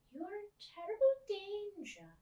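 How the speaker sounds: put-on high, loud old lady; old woman warning of danger. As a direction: warn her in a high voice. Miss Spink's ominous warning.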